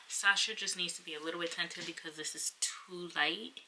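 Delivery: unvoiced whisper about Sasha; secretive hush. Real, not acted. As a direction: whisper it aside. Whispered aside.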